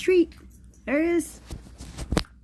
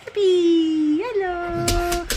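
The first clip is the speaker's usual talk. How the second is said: excited dog greeting; 5 semitones higher, much slower than usual. Real, not acted.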